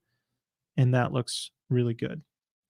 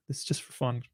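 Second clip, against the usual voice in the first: softer, under the breath; quiet aside; 3 semitones lower, 12 dB quieter, faster than usual. Shown as a demonstration. Repeats the disclaimer twice.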